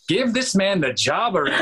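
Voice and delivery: high-pitched acted exclamation; retold high, amused outburst; enthusiastic verdict. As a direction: proclaim it like a won-over producer.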